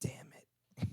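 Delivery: slow, hushed, exasperated curse; mad under breath. Acted. Store clerk watching her grab bottles, quietly fuming. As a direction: curse softly to yourself, drawn out. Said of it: "he's so mad".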